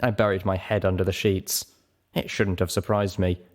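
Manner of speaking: usual speaking voice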